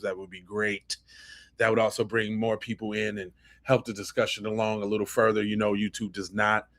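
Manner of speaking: quick hushed shout-out to the audience; low, soft, fast viewer plug. Real, not acted. Host asking viewers to hit like, quick and low. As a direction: murmur the like-button plea fast and low.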